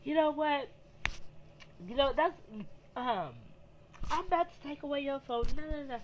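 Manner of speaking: nagging mom. Retold or imitated. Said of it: "my mom over here yelling at me".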